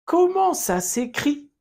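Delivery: high, clear model question for the class to repeat; frase modelo. Shown as a demonstration. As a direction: say it as a teacher's model line. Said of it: "Répète". (pregunta modelo clara y aguda)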